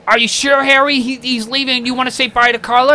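high, loud, questioning plea; imitated coaxing wife. Imitating mom urging husband to say bye, high coaxing voice. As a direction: play a wife nudging her stubborn husband.

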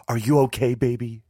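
high, loud worried question; alarmed maternal concern; anxious mother. A narrator's reading. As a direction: urgently check on your shaken child. Frightened mother embraces her child.

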